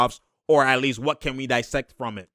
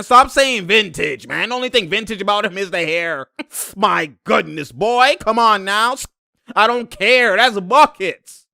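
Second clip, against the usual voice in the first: higher, louder excited insistence; hyped rant; 8 semitones higher, 5 dB louder than usual. Real, not acted. Defending Melo to viewers, loud and hyped. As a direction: preach it to the doubters.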